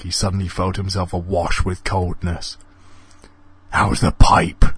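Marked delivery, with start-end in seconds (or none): 3.7-4.7 sneering menace. A narrator's reading. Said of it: "sneered Alan menacingly".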